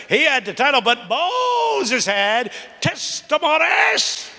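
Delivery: high, rising preacher's cadence building to a cry; full-force preaching. Acted. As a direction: preach it like a Baptist pulpit. Preaching the sermon retold.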